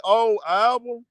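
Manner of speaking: ordinary delivery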